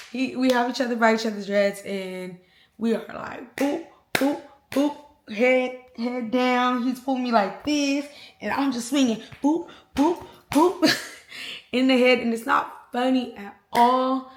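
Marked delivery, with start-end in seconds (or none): 3.6-5.0 higher, spaced-out boops; imitated punch sounds. Showing how they hit each other by the dreads, imitated punch sounds. Imitates blows while tussling. 9.4-11.0 high, playful boops for punches; imitated punch sounds. Storyteller showing herself just swinging, imitated punch sounds.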